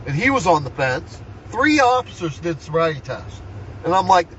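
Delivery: normal talking voice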